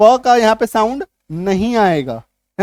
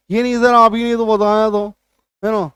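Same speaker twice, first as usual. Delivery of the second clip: lightly mimicked complaining student; mock student objection. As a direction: run it together as a droned protest. (छात्र की शिकायत की हल्की नकल)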